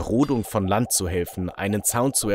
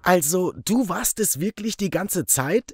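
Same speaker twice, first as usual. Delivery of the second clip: betrayed friend; 7 semitones higher than usual. Acted. Barry confronts the narrator.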